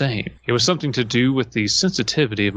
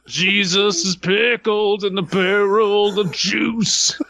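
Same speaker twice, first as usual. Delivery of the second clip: high, slow, grandiose reveal; dramatic announcer voice; 9 semitones higher, slower than usual. Acted. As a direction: proclaim it like a solemn prophecy. Comic dramatic punchline voice.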